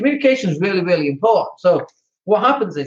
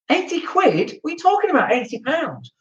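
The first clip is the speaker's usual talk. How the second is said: high-pitched, disbelieving protest; mock incredulous; 8 semitones higher than usual. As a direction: balk at an outrageous price. Mock outrage at plumber's fee.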